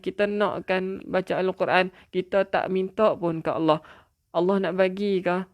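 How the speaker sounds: normal talking voice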